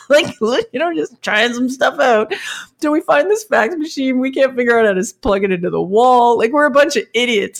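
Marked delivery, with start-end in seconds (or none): none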